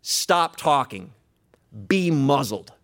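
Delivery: slow, loud, falling command; commanding rebuke. Acted. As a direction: order the storm silent, phrase by phrase. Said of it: "stands up and says".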